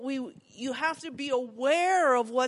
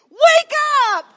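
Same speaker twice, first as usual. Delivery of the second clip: sudden shout, much higher and louder; shouted wake-up call; 19 dB louder than usual. Retold or imitated. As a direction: yell it to jolt sleeping boys awake. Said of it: "I never went in and went".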